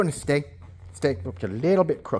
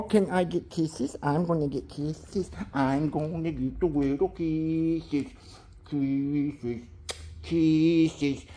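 soft coaxing; 7 dB quieter, much slower than usual. Real, not acted.

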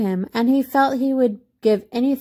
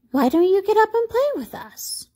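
high-pitched storybook rabbit; high little animal voice; playful rabbit voice; 11 semitones higher than usual. A narrator's reading. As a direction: invite a playmate in a high character voice. Wild rabbit invites him to play.

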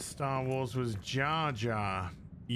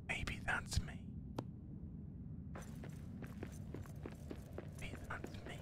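whisper; 14 dB quieter than usual. Pretend. Whispered self-answering bit.

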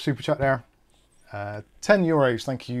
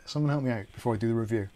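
ordinary delivery

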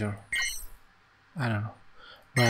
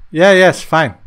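loud, annoyed yes; impatient outburst; 5 semitones higher, 9 dB louder than usual. Real, not acted. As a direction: snap it out impatiently.

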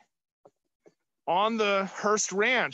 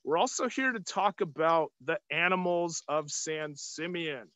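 ordinary talk

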